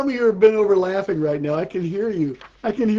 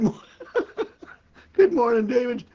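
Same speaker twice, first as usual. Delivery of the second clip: higher greeting broken up by a laugh; real laughter; 5 semitones higher than usual. Real, not acted. Comedian greeting a viewer mid-show, cracking up laughing.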